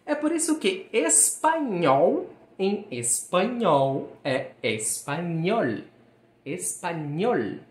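"espanhol" com ñ como NH, "espanhol" bem articulado, devagar. Shown as a demonstration. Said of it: "som equivalente ao som do nosso N H". ("espanhol" com ñ nítido)

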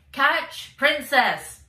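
high, loud call across the field; shout. A narrator's reading. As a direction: shout it over the noise. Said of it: "came a shout".

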